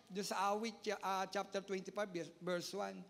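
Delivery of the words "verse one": ordinary delivery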